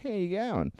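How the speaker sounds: GOING swooping high, then dropping; "How you going?" with a big high inflection; inflected punchline. Shown as a demonstration. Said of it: "I inflect on my punchlines".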